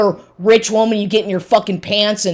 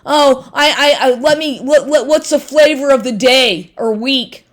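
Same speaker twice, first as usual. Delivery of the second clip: mocking imitation; 5 semitones higher than usual. Mocking ex choosing women.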